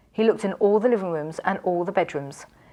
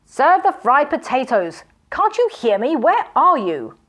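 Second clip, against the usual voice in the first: loud, slow, rising impatience; impatient calling; 6 semitones higher, 5 dB louder than usual. A narrator's reading. Magician calling into the silent castle, loud and impatient. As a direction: demand an answer from the empty house. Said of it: "cried Zuckerman".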